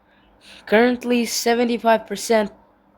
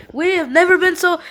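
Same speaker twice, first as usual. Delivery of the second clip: excited; 9 semitones higher than usual. Real, not acted. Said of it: "never been more back".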